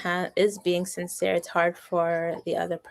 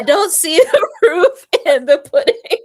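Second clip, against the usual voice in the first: laughing; 7 semitones higher, 11 dB louder than usual. Real, not acted. Laughing through the punchline.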